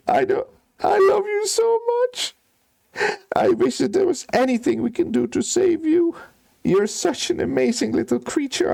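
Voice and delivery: high wailing plea over his dying bird; sobbing steward. Acted. Steward weeping over his dying bird, high wailing. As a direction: wail high, begging through tears. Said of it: "And he starts crying".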